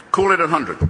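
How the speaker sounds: mock haggling.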